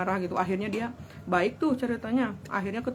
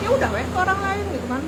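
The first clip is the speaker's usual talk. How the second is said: fast, higher, louder dismissal; annoyed; 9 semitones higher, 4 dB louder, much faster than usual. Retold or imitated. Woman dismissing her boyfriend out of irritation, annoyed. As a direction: brush him off in a rush of irritation. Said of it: "Saking jengkel gitu".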